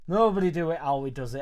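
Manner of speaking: loud proud catchphrase call; catchphrase shout. Acted. Streamer shouting his catchphrase at the live chat. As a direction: bellow the sign-off slogan.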